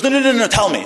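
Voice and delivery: high, loud rapid protest; urgent pleading. Retold or imitated. Woman begging the rabbi for advice, urgent pleading. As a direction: blurt out an insistent plea.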